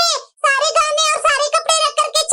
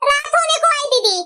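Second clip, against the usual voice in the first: ordinary talk, nothing marked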